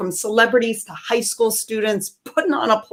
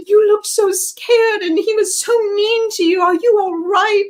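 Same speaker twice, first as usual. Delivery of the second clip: much higher, put-on voice of concerned pub strangers; mock worried onlookers; 11 semitones higher, 3 dB louder than usual. Pub strangers fussing over the scared comedian. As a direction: coo it like anxious strangers. People voiced high and far.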